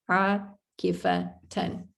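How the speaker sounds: akifatan drawn out slowly; slow model word. Shown as a demonstration. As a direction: spell out akifatan with the fathatain ending. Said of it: "Like that".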